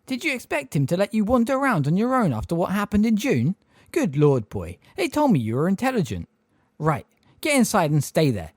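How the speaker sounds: a hint of a flustered old neighbour; Miss Fig. A narrator's reading.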